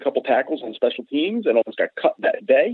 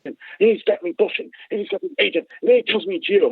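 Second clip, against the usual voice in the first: lightly voiced hyper Joe Pesci, higher; a hint of fast-talking Pesci; Joe Pesci impression; 6 semitones higher than usual. Coworker Dave gossiping about Tony's agent, a light Joe Pesci voice. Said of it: "He's like Joe Pesci".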